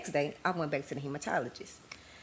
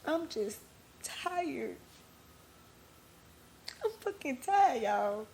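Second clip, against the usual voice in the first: high, cracking voice close to sobbing; breaking into tears; 6 semitones higher, slower than usual. Real, not acted. Host to her listeners, finally admitting she's exhausted, crying. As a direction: let the tears break through the words. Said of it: "I said I wasn't gonna cry".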